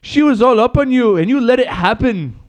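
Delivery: high mock-furious wife; hammed-up jealous accusation; Conor's wife. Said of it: "you Connor's wife".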